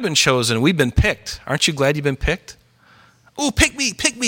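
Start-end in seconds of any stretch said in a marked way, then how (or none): 3.4-4.3 put-on high, eager "pick me" like a kid waving a hand; eager kid begging. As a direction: beg to be picked, bouncing up high.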